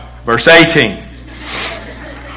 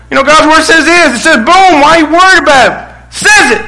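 high-pitched insistent quoting; acting out an exasperated, Bible-quoting parent; insistent parental preaching; 11 semitones higher than usual. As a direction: press the point at doubting kids. Parent preaching scripture at kids.

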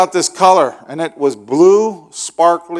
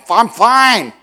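curt, higher grumpy snap; 7 semitones higher, 4 dB louder than usual. Retold or imitated. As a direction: brush her off, irritated. Grumpy husband answers wife.

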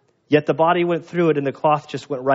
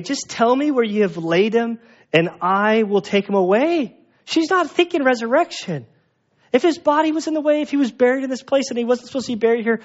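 pleading, frustrated; 8 semitones higher than usual. Retold or imitated. Mary pleading with the gardener.

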